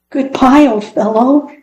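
calling after the lost dog, mildly sad; called out sadly. A narrator's reading. Boy calls goodbye to the duck flying off, mild sad call. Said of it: "he called out sadly".

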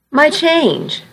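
high, small nervous reply; gulped out. A narrator's reading. Girl asking cashier for her money, nervously gulped. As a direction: get it out through a nervous gulp. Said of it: "I gulped".

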